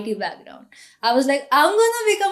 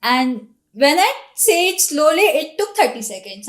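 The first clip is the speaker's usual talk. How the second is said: usual speaking voice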